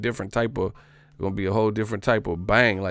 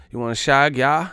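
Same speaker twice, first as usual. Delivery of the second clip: louder mock British accent, higher; 5 semitones higher, 7 dB louder than usual. Mocking English pickup talk, loud mock British accent. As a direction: ham up a cheeky English pickup line. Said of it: "that's not how we say".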